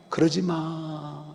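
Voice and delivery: soft, slow, mild coaxing; an indulgent father softly chiding rude sons; gentle admonishing. Retold or imitated. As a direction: chide them kindly like a lenient father. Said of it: "점잖게 이 아이들을 타이르는 거예요".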